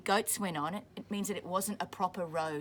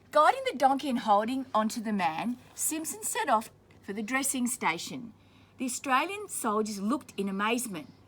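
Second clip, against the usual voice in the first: clear, steady storybook reading; reading to children; 3 semitones higher, 3 dB louder than usual. A narrator's reading. Teacher reading a war story to children, steady storybook voice. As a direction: read it out for the class. Reading picture book aloud to class.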